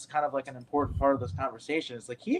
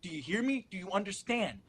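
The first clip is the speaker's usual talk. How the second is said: a hint of an urgent older actor, higher; urgent warning; 6 semitones higher than usual. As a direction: press the warning home, lightly voiced. Mentor urging him to run.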